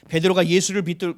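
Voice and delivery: loud, solemn Bible reading; preacher reading the Gospel aloud; scripture reading. Acted. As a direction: declaim the verses from the pulpit.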